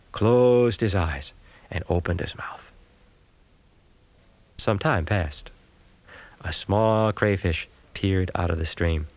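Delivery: ordinary delivery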